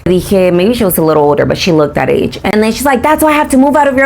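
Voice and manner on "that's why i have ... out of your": high, rapid mimicry; mock teenage retort. Teenager justifying her lane change, high rapid imitation. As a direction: rattle it off as a snippy teen.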